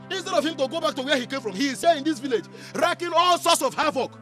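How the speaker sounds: loud, heated complaint; indignant rant. Acted. Villager demanding the Igwe act against Arinze, indignant rant.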